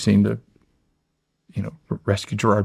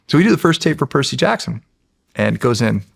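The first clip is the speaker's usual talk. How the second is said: usual speaking voice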